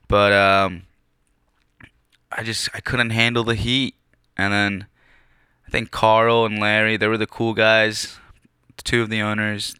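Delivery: ordinary talk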